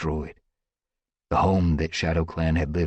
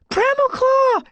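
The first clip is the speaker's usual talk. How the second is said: high, loud panicked shriek; 7 dB louder, slower than usual. A narrator's reading. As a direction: scream his name in terror. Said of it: "she shrieked".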